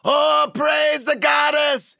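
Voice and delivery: loud, high, drawn-out cry of thanks; weeping relieved cry. A narrator's reading. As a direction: wail it out slowly, high and loud. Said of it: "started to weep".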